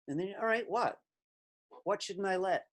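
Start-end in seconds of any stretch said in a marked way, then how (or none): none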